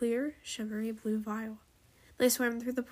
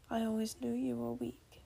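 soft, understated snarl; low snarl; 7 dB quieter than usual. A narrator's reading. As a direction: snarl it softly, with quiet contempt. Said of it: "Shotgun snarled".

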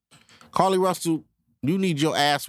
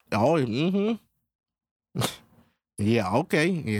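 shady Hollywood boss. Acted. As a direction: lean in like a fixer sealing the deal. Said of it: "I put it in that tone".

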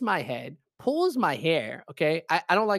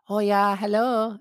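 drawn-out, higher, mock Chinese accent; imitating Chinese-accented old lady; 5 semitones higher, 4 dB louder, slower than usual. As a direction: greet like an old Chinese masseuse, accent and all. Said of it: "I see this old lady".

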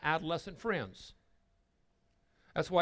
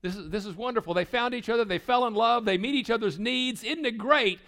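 higher, louder gushing praise; imitating a listener's naive enthusiasm; mock gushing; 8 semitones higher, 8 dB louder than usual. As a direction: ham up naive delight. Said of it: "You say".

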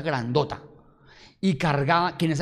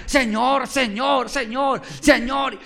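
high, loud repeated cries; repeated shouting; 8 semitones higher, 5 dB louder, much slower than usual. Acted. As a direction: keep calling out, louder than the crowd. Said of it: "comenzó a gritar".

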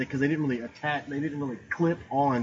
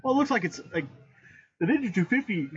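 yawning; 9 semitones higher, faster than usual. Real, not acted. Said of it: "why am I yawning?".

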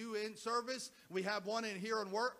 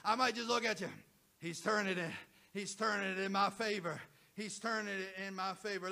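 rising, rhythmic preacher's call and repeat; full-force preaching; 3 semitones higher, 4 dB louder than usual. Acted. Pastor rallying the church online, preaching at full force. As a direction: drive every line home like a revival sermon.

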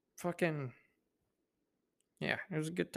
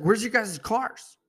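louder, higher alarmed ask; alarmed question; 8 semitones higher, 9 dB louder, slower than usual. Retold or imitated. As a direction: call out in alarm for the missing cars. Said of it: "I was like".

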